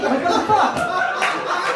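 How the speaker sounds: slow, high, laughing disbelief; baffled disbelief. Real, not acted. Comic reacting to the fan remark, slow incredulous laugh. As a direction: drag out the outrage.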